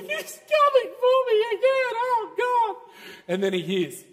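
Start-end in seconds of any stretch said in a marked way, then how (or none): 0.0-2.8 high, loud, fearful shout; terrified cry. Acted. Man huddled in bed, terrified of the devil, shouting. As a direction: cry out in dread under the covers. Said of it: "It's like".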